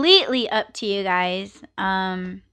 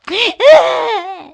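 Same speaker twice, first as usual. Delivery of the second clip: loud burst of laughter; 13 semitones higher, 11 dB louder than usual. Real, not acted. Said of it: "laughing after fake cry".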